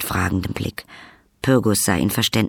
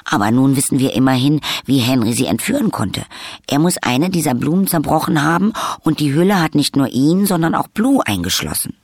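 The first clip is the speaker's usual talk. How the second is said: eager rush, high and louder; eifrig; 6 semitones higher, 4 dB louder than usual. A narrator's reading. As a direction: explain it excitedly, pitch climbing. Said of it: "sagte Pyrgus eifrig". (eifrig, hoch und aufgeregt erklärt)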